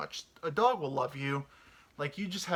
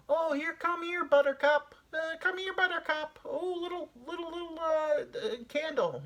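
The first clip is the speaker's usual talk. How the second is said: high, sing-song call to a dog; high baby-talk call to a pet. Pretend. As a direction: coax the dog over in a high voice. Calling a dog by name.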